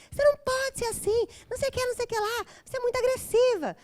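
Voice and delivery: high nagging mother voice; mother scolding daughter for being aggressive, hammed-up; imitating scolding mother. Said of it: "minha mãe falava assim".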